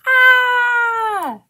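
high, loud, sustained scream; long storybook shriek of fright. A narrator's reading. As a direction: scream out on one held high note. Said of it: "they both screamed".

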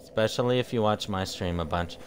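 ordinary delivery

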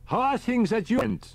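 put-on high, fussy elderly professor; a high old professor calling on the radio; professor's voice. A narrator's reading. Riker on the radio.

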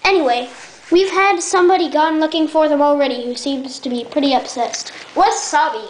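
ordinary delivery

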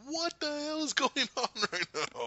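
high-pitched incredulous outburst; mock astonishment. Fan reliving seeing all these characters, amazed outburst. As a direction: act out a stunned viewer, pitch way up.

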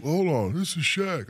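high, loud, slow mock realization; imitating the called friend. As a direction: act out catching the prank caller. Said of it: "And he's like".

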